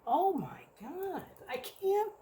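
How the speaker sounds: high, slow, strained voice then a whisper; losing it, then catch your breath; overwhelmed. Real, not acted. Reviewer too excited to read label, overcome.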